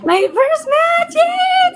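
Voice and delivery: overjoyed thanks to the chat; thrilled squeal. Real, not acted. Thanking viewers for gifts.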